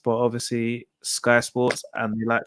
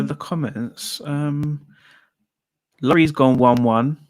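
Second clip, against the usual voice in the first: normal talking voice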